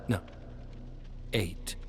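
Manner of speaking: usual speaking voice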